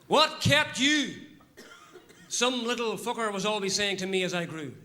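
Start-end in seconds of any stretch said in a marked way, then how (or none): 0.1-1.2 slow, heavily spaced sarcastic question, raised pitch; mock-inquiring taunt; sarcasm. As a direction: draw out each word at the latecomer. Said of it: "I knew it was sarcasm".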